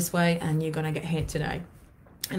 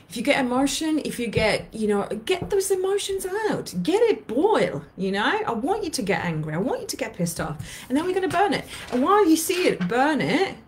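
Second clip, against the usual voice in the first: fired-up coaching; 8 semitones higher than usual. Real, not acted. Life coach urging viewers to burn letter, fired up. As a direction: whip up the anger, pitch climbing. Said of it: "coaching listeners to vent".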